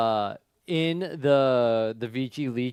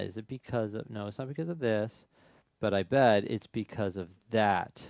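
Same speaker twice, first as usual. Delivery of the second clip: usual speaking voice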